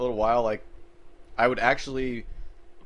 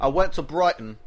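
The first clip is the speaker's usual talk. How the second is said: put-on British accent; 2 semitones higher, 3 dB louder than usual. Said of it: "British accent".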